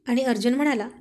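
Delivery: ordinary delivery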